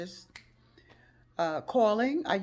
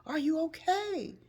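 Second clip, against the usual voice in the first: worried; 13 semitones higher than usual. Retold or imitated. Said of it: "That's the first thing I wanted to know".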